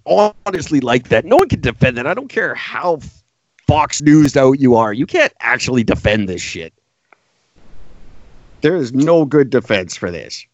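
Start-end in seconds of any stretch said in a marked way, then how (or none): none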